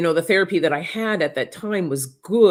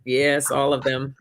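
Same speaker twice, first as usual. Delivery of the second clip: ordinary talk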